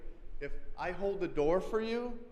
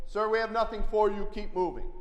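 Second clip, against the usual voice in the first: raised voice, louder, telling a stranger off; firm loud warning; 5 semitones higher, 7 dB louder than usual. Retold or imitated. Over wife's head to an approaching stranger, loud firm warning. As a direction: plant your feet and warn him off, louder. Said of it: "And the louder I got".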